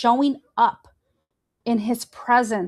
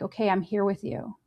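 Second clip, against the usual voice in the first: soft, quiet comfort to someone panicking; gently calm a friend in panic; quiet, steady reassurance; reassuring; 3 semitones lower, 5 dB quieter than usual. Retold or imitated. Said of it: "be like".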